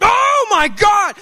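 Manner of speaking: startled screech of panic at the wheel; freaked-out scream. Retold or imitated. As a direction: scream it like a mosquito just grazed your nose. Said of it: "I was like".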